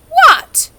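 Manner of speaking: high sulky what; sulkily. A narrator's reading. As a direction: snap it out sulkily. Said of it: "she said sulkily".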